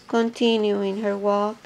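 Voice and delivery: ordinary delivery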